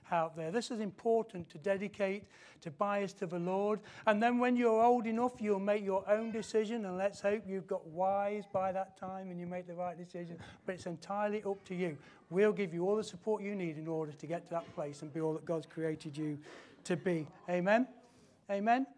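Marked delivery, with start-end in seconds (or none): none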